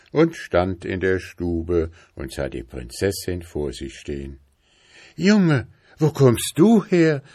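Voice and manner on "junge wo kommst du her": high, loud cry of surprise; astonished princess, pitch leaping up. A narrator's reading. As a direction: call out in amazement. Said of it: "rief sie erstaunt".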